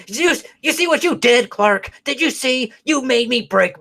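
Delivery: high whiny villain taunt. Pretend. Vlogger imagines blaming Clark after the punch, high whiny villain voice. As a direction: play the villain blaming the hero. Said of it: "like, a villain".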